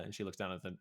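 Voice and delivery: ordinary delivery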